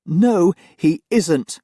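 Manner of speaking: slow, firm and loud; stronger voice. Acted. Nanny contradicts her master, slow and firm. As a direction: stand your ground, each word deliberate. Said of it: "she said in a stronger voice".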